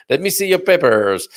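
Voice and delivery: mock French official demanding papers in a French accent; a light put-on French accent, stern and official; hint of a French border guard asking for papers. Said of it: "don't know why I did a French accent there".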